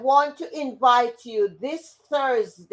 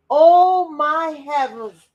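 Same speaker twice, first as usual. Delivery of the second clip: excited; 5 semitones higher, 6 dB louder, slower than usual. Real, not acted. Delight at friend's news.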